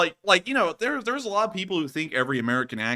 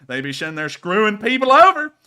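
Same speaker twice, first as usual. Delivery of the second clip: put-on Southern accent building to a rant; 7 dB louder than usual. Ranting about the federal government in a Southern accent. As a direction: get worked up about the IRS, Southern style. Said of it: "legible through that accent".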